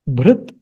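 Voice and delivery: bhrit as one syllable; low-pitched bhrit, stopping on the t; correct pronunciation. Shown as a demonstration. Teacher repeats correct form, bhrit without final vowel. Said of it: "we will pronounce it as".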